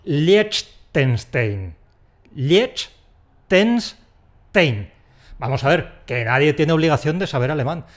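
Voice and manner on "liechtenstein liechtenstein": Liechtenstein read letter by letter, very slowly; as written. Shown as a demonstration. Honest speaker reading it as spelt, slow. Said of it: "pronunciarlo como está escrito".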